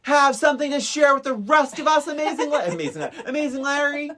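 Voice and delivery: loud, high, mocking snap; angry Pee-wee. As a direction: bark it high and sarcastic. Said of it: "he's like".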